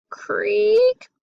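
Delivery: high, drawn-out squeaky creak; long rising creak; creaking stair. A narrator's reading. Voicing creaking stair in story.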